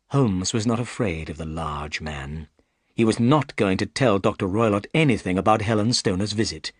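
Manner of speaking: plain reading voice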